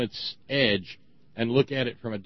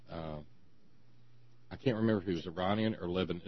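ordinary delivery

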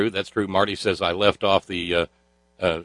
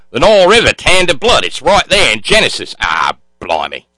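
put-on cheesy Australian accent; 4 semitones higher, 5 dB louder than usual. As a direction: protest in a hammed-up Australian accent. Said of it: "my cheesy Australian accent".

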